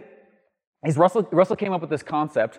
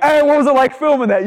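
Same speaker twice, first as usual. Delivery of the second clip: talk show host; 10 semitones higher, 8 dB louder than usual. Retold or imitated. Talk show host questioning the star.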